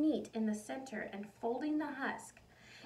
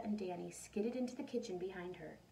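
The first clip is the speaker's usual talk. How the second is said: usual speaking voice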